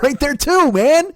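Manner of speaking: high, loud burst on "man"; gleeful peak of the rant; excited exclaim. Real, not acted.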